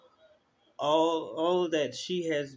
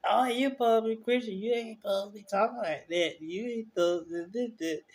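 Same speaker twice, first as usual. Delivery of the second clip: mocking scold; 8 semitones higher than usual. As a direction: mimic a preachy critic, voice pushed higher. Mocking critics of Christians.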